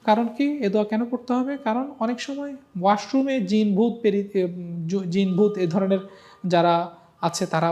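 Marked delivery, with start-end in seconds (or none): none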